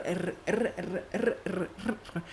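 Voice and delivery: repeated trilled R, very soft; practicing the R sound; rolled R drill. Shown as a demonstration. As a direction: drill the R sound over and over, quietly. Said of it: "eu ia na rua dizendo R".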